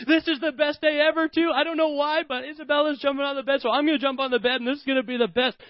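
put-on high, rushing little boy; little brother joining in the fun; excited boy. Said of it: "he's like".

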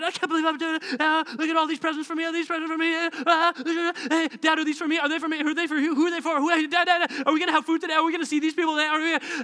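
high-pitched, gushing babble; excited kid impression. Kid bombards Dad about Christmas presents, babbling excitedly. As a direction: tumble over your questions like an overexcited child. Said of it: "it can be excitement".

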